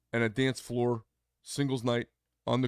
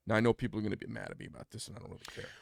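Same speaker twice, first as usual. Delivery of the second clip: normal talking voice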